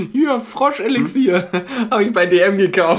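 high, laughing saleswoman pitch; giggling beauty-vlogger sales pitch. Pretend. Mocking YouTuber cosmetics seller.

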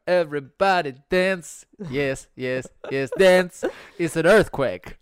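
loud, high party call; hype shout. Pretend. Host rallying the crowd to dance, loud hype shout. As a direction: whip the room up to dance.

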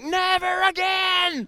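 very high, loud, drawn-out cry; emphatic vow. Retold or imitated. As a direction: wail it out slowly at full pitch. Vow after the roller coaster ride.

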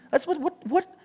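high-pitched stunned what what; incredulous. Real, not acted. Preacher amazed by the hymn's wording, high incredulous squeak. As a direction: squeak out the disbelief. Disbelief at his own joke.

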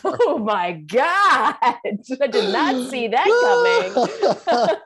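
real laughing shock; shocked laughter. Real, not acted. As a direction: laugh in disbelief at the number. Shocked by the death toll.

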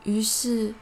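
ordinary delivery

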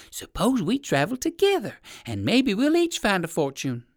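high-pitched little dog voice; eager little poodle. Acted. Poodle proposing to travel together, eager.

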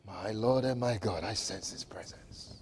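whispered sense of holy presence; hushed prayer. Real, not acted. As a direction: whisper it as if God is in the room.

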